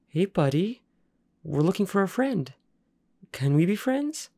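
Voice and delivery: high, gentle coaxing voice, palms out; coaxing a dog. Acted. Boy soothing a snarling guard dog, high and coaxing.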